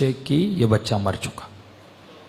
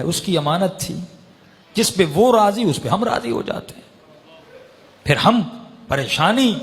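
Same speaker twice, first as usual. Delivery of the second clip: raised, high-pitched preaching at full force; impassioned preaching; 5 semitones higher than usual. Acted. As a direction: lift the voice and drive the lesson home. Preacher voicing the imam's answer.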